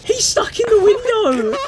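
high, loud yell through laughter; laughing yell. Real, not acted. Teammate stuck in the window, laughing yell.

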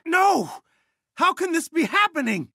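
very high, distraught cry; anguished disbelief. Acted. Commander watching a team member fall, anguished disbelief. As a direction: wail it in shocked disbelief. Resistance member lost in battle.

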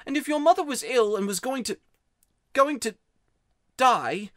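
put-on high, upset boy, stumbling before the last word; wound-up boy. A narrator's reading. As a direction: build loudly, halt, then force it out. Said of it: "too wound up to take any notice".

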